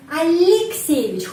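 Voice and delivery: slow emphatic model of the patronymic; slow name demo. Shown as a demonstration. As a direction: stretch out Alexeyevich syllable by syllable. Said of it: "huruf Y dibaca I".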